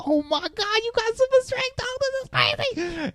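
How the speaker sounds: very high falsetto gushing; hammed-up, high-pitched thrill; mock overexcited dad. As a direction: squeal with pretend fatherly excitement. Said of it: "He's so excited".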